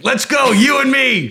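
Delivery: high, loud taunting call to a wild animal; mock fight challenge. Wife playfully challenging a wild animal to fight. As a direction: square up to the beast, playfully.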